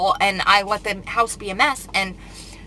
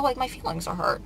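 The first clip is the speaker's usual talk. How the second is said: normal talking voice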